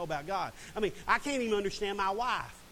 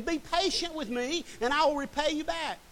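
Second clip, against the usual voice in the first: mild pleading; 8 semitones higher than usual. Acted. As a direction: beg him on your knees, lightly voiced. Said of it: "fell to his knees and begged him".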